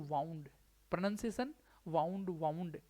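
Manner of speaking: वाउंड said with "ow" as in "wound up"; pronunciation demo. Shown as a demonstration. Teacher telling students how to say वाउंड, pronunciation demo. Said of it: "इसका pronunciation होगा वाउंड".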